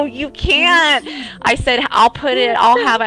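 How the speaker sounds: high, loud, laughing no; loud laughing protest. Retold or imitated. Arguing with husband, laughing.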